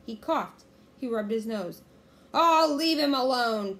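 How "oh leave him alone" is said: loud, slow, raised growl; pirate growl. A narrator's reading. Pinky growling at Jack, loud drawn-out growl. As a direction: growl it out slowly and loudly. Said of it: "Pinky growled at Jack".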